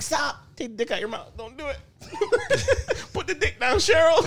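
high, laughing fake begging; laughing mock plea. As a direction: beg through laughter, hammed up. Mock pleading with imagined girlfriend.

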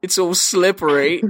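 mock movie-character delivery; quoted film line. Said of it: "and he says".

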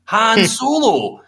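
high, slow, stretched Scottish cry of Han Solo!; Scottish film shout. Retold or imitated. A Scottish fan mocking a film line, drawn-out Scottish yell. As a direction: ham up a Scottish movie character yelling the name. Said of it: "when I hear a Scottish accent on a film".

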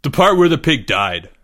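confident schoolboy answer. A narrator's reading. Boy answering teacher about least favorite part, confidently. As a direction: say it with assurance. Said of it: "said Greg confidently".

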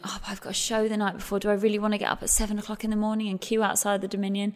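quick, hushed grumble to herself; reluctant inner voice. Retold or imitated. Tired performer talking herself out of an early audition, quick and hushed. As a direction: rush through the excuse under her breath. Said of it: "part of me said".

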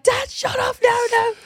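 high, squealed mock protest; mortified. Mortified daughter at McDonald's drive-through, high squealed protest. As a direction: act out a child begging dad to stop.

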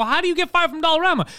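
high-pitched mock disbelief; retold exasperated yelling. Friend questioning a fired friend, mock exasperation. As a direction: reenact an incredulous scolding, pitch climbing. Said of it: "Last time I'm yelling".

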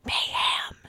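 soft whispered warning; hushed single-word alert. Acted. Whispered exchange while eavesdropping.

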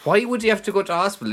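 fast, high disbelief; incredulous. Real, not acted. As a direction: ask it in disbelief. Incredulous rant.

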